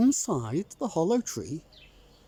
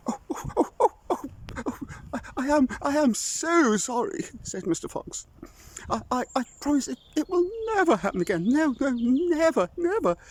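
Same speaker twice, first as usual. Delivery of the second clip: high, fast stammering apology; a scared fox babbling sorry; high nervous stammer; frightened fox; 11 semitones higher, faster than usual. Acted.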